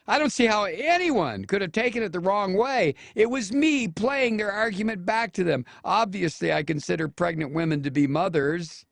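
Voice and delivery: high-pitched, put-on whiny protest; mock him in a high, insistent voice throughout; mocking senator voice. Host reads the senator's defence aloud, high mocking voice.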